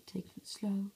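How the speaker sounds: usual speaking voice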